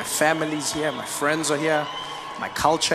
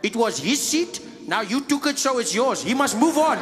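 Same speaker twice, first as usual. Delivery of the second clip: put-on Afrikaans accent. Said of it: "he said".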